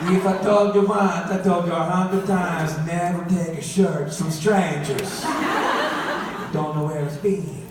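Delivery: hammed-up Italian accent, lecturing a child; bad Italian accent. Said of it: "My mom always talks in a bad Italian accent".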